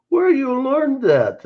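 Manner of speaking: high, slow incredulous question; quoted question. Retold or imitated. Retelling at home, quoting the question high and slow. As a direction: mimic the puzzled asker, drawn out.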